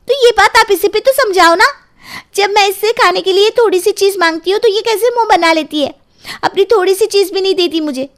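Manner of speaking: put-on high, whiny child complaining to grandma; sulky little girl. Girl complains to grandma about her mother, whiny child voice.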